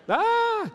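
put-on sudden high yelp of a scared kid; mock scream of fright. Child scared by mounted heads.

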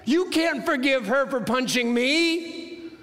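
high-pitched, slow, drawn-out protest; indignant six-year-old. Retold or imitated. Punched boy objecting to his brother, high indignant protest. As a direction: play the wronged brother, pitched high and emphatic. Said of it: "He's gonna say".

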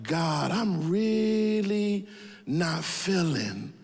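slow, dragging reluctant plea; mock-reluctant slow whine to God; reluctant drawn-out prayer. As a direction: stretch every word like you don't want to go.

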